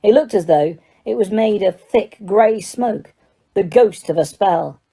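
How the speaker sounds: ordinary delivery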